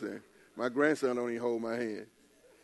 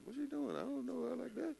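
high puzzled inner voice; 11 semitones higher, 10 dB quieter than usual. Retold or imitated. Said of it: "I'm like".

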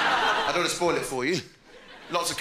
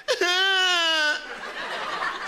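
long high fake cry; mock wail; 13 semitones higher than usual. Youngster bullied by text, high mock wail.

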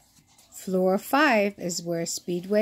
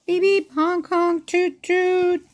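high beeps, honks and toots; horn noises; 9 semitones higher, 4 dB louder than usual. A narrator's reading. Reading about the street, high playful horn noises. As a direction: play the traffic horns.

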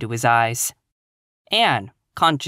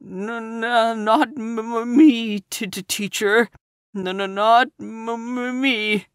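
put-on higher, panicked boy stuttering; wild stutter; 9 semitones higher, slower than usual. A narrator's reading. Guilty pupil denying to teacher, stuttering wildly. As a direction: trip over every sound in fright. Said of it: "stuttered out wildly".

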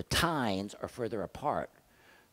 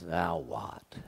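a light hint of a weary wife; 4 semitones lower than usual. Wife answering her husband's latest plan, lightly weary. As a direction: sigh it out, eyes rolling.

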